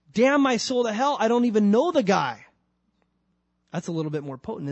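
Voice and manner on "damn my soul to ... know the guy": loud, high, vehement oath; swearing oath. Acted. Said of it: "He was saying".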